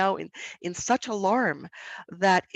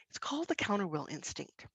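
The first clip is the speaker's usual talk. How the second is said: whispered; 9 dB quieter than usual. Real, not acted. Naming the instinct.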